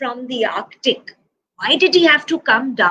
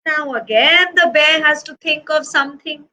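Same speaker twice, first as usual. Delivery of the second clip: usual speaking voice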